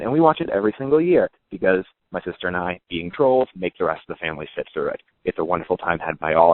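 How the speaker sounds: normal talking voice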